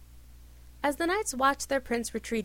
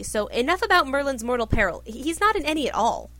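loud, raised exclamation; exclaimed; 3 semitones higher, 7 dB louder than usual. A narrator's reading. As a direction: burst out to end the chatter. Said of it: "Arthur exclaimed".

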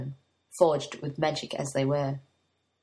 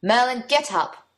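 higher, louder shout of a name; loud wake-up call; 6 semitones higher, 6 dB louder than usual. Acted. Prince waking his servant by shouting in his ear. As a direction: bark him awake right in his ear. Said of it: "uttering aloud ... in his ear".

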